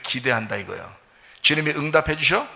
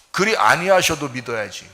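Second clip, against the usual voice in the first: normal talking voice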